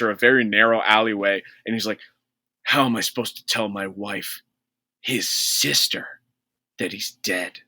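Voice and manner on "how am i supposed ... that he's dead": softer, hushed mock grief; a hushed, slightly mournful Hall. Hall wonders how to tell his wife, softer mock grief.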